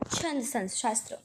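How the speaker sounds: usual speaking voice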